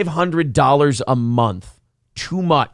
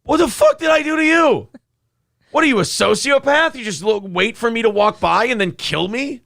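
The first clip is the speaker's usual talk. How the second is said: outraged deer voice; 8 semitones higher than usual. Pretend. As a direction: play a furious wounded deer. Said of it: "they go".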